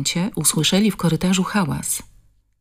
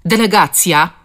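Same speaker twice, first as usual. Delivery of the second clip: louder, scornful jab; contemptuous; 4 semitones higher, 6 dB louder than usual. Acted. Suspect sneering at the police, loud and contemptuous. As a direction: sneer at the visitors. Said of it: "rzuciła pogardliwie".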